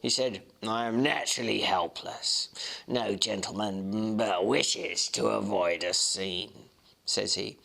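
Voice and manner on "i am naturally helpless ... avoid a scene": hint of a sneering Mr Hyde, unhurried; sneering gentleman. A narrator's reading. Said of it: "carrying it off, sir, really like Satan".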